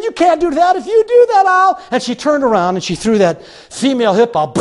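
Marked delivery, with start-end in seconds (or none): 0.0-1.8 high, raised pleading threat; acted desperation. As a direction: plead in panic as she leaves. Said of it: "You're desperate".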